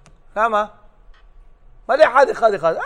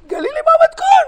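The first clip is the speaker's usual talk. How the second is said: high mock woman's voice; 5 dB louder than usual. Woman asks rabbi's wife for recipe.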